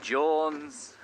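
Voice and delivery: drawn-out Welsh surname, hammed-up; Welsh accent. Shown as a demonstration. Presenter trying out Welsh rugby names, mock Welsh accent. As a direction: try the name in Welsh. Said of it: "a Welsh rugby side".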